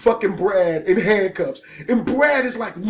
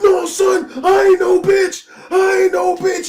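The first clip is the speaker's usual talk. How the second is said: high, loud mock shouting; imitated worked-up defiance; defiant tough guy; 7 semitones higher, 6 dB louder than usual. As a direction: holler it like a hothead refusing to quit. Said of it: "so serious about proving to us".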